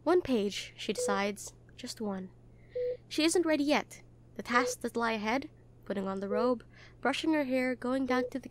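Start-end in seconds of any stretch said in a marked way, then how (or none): none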